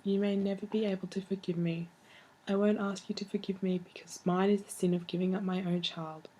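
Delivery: ordinary talk, nothing marked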